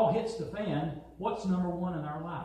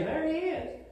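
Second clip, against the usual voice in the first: mock pointing out; 11 semitones higher than usual. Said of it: "why don't you say".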